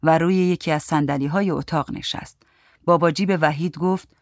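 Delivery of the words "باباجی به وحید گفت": ordinary delivery, nothing marked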